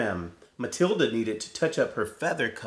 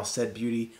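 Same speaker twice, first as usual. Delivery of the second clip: usual speaking voice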